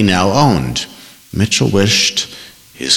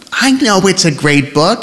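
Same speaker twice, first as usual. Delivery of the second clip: whiny; 10 semitones higher than usual. A narrator's reading. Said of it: "how much like Paula he sounded when he whined".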